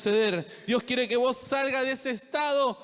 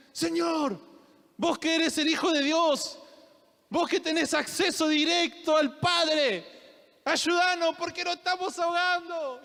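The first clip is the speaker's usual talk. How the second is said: desperate plea; 6 semitones higher than usual. Acted. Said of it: "decían".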